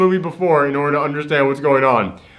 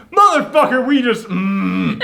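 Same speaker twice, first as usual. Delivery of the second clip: high mock furious yell that chokes off; half-laughing fake rage; mock outburst; 6 semitones higher than usual. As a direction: blurt it out then swallow the rest. [name]'s angry reaction after landing.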